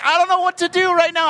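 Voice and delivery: high-pitched, flustered retelling of panic; panicked. Retold or imitated. Acts out his panic mid-flood.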